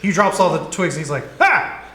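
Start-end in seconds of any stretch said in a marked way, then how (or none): 1.4-1.8 sharp high startled cry; startled goblin yelp. Acted. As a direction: yelp in sudden fright.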